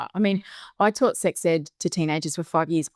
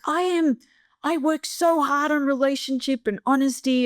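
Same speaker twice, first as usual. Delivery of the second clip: high, indignant self-righteous protest; mock-indignant parent venting; retold anger; 8 semitones higher than usual. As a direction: re-enact being pissed off, pitch raised. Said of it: "I was angry, like, I was pissed off".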